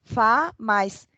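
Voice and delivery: slow, syllable by syllable; slow syllable drill. Shown as a demonstration. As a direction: spell out the syllables like a first-grade teacher. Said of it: "Lembram disso?". (soletrando devagar, sílaba por sílaba)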